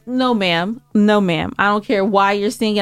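normal talking voice

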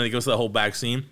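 usual speaking voice

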